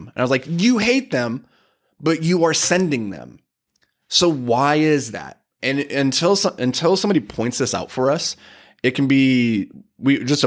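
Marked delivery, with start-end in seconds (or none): 0.5-5.4 slow, higher, disbelieving retold challenge; slow, incredulous questioning; pointed challenge. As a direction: confront the student slowly, word by word. Retold challenge to his student.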